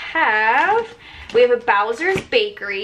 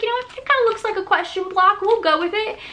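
high mock voice; 10 semitones higher than usual. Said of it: "but I was like".

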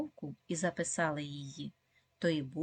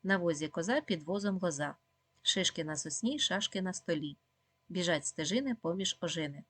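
quick tongue-twister rattle; fast recitation for children; even faster. Shown as a demonstration. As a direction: rattle the rhyme off at speed. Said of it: "А тепер ще швидше".

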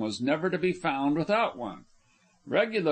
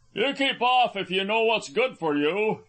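high, raised threatening call; big fisherman calling a threat, pitched up; called-out warning; 7 semitones higher, 3 dB louder than usual. A narrator's reading. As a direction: warn them off from across the water. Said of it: "he called out suddenly".

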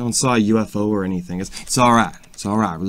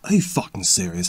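normal talking voice

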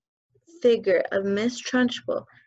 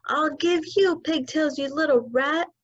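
higher, menacing yell; 6 semitones higher than usual. Acted. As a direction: snarl it while spinning her. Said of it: "the Trunchbull was yelling".

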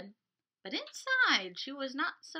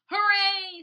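loud cheer, less high; group cheer; 8 semitones higher, 10 dB louder than usual. Acted. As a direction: cheer along with friends. Walter and others cheering.

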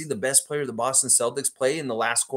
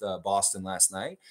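ordinary talk

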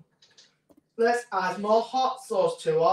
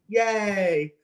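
normal talking voice

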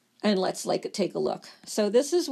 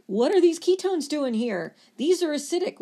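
alarmed kidney; 6 semitones higher, 4 dB louder than usual. Acted. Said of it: "And it's like".

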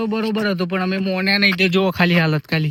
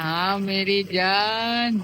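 very slow, stretched "હા મેરી જાન" with a long "જાન"; slow mock-filmy drawl on "જાન"; drawn-out catchphrase; much slower than usual. Repeats catchphrase, stretched slowly.

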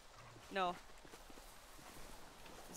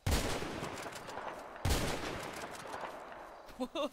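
long gasp; 4 semitones higher than usual. Real, not acted. Startled on steep slope.

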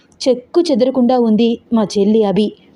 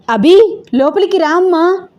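high, loud anxious call; mother shouting to daughter outside in the wind; calling out loudly; 9 semitones higher, 4 dB louder than usual. Acted. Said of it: "గట్టిగా పిలుస్తూ". (గట్టిగా కంగారుగా పిలుపు)

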